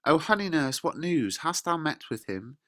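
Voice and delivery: high-pitched, lightly acted eager questioning; eager Juliet. Juliet questions the nurse for news, acted eagerness. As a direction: jump in eagerly, voice lifted. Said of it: "Juliet jumps right in".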